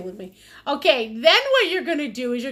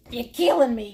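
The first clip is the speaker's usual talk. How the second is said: ordinary delivery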